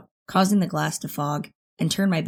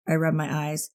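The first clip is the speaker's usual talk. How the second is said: plain reading voice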